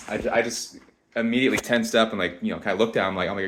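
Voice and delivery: normal talking voice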